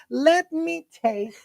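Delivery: very slow, high "Let me taste"; drawn-out insistence. Shown as a demonstration. Boyfriend shows how he really asked, drawn-out insistence. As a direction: stretch each word of "Let me taste" insistently. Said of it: "It was more like".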